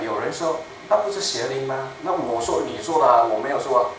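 ordinary delivery

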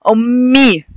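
"أمي" with a long hummed m and clear final ee; doubled m demonstration. Shown as a demonstration. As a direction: hold the double m in "أمي" for the learners. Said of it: "عشان بيتنطق مرتين".

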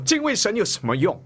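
high complaining wife's voice; mock bitter wife. Bitter wife scoffing at her husband's faith, high whiny voice. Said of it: "她没有说".

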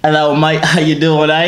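loud, cheeky fake British greeting; hammy British "mate" greeting; British accent. Shown as a demonstration. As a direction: practise a put-on British accent. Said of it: "let me practice".

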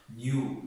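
نیو said with a clear yu sound; teacher stresses the yu in نیو; new with yu. Shown as a demonstration. Teacher shows students the yu sound in نیو.

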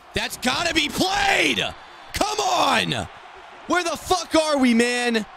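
high, strained frustrated yelling; outraged high-pitched outburst; furious shout. Real, not acted. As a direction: holler at your useless defense. Yelling at blown coverage.

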